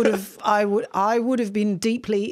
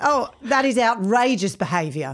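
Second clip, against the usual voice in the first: broad Aussie vowels, fully committed; a second go at the Aussie soap line; Neighbours-style Australian accent. Pretend. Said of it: "Oh, that's good".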